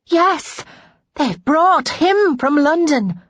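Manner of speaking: high, excited maid; breathless high-pitched announcement; feverish excitement. A narrator's reading. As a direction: blurt out thrilling news. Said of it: "gasped with exertion and feverish excitement".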